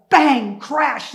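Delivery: explosive, punched words; sudden loud bang. Retold or imitated. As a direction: hit each word like a crash. Imitates sudden crash in the room.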